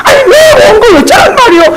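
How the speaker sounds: high, laughing crying-out protest; hammed-up indignant deacon; exasperated retort. Deacon retorting about his outfit.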